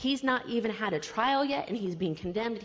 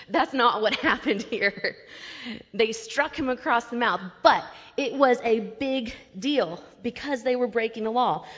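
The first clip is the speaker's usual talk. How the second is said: ordinary talk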